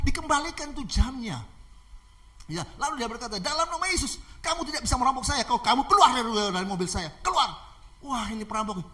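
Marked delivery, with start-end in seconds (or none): 3.4-7.6 high, insistent rebuke; commanding. Acted. Pastor ordering robber out of his car, commanding. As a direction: order him out, punching the key words.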